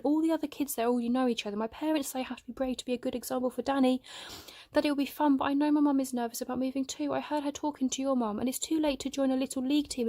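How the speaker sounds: put-on a boy spilling every fear at once, voice climbing; boy confessing fears of moving, rising upset; upset outpouring. Upset boy pours out worries.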